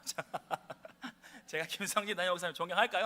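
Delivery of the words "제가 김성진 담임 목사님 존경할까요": louder, faster with a laugh in it; livelier retry. Shown as a demonstration. Pastor re-asks the congregation, louder and laughing. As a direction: pump the crowd up, laughing, quicker. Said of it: "반박자 늦으셨거든요".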